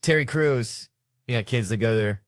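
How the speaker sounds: ordinary delivery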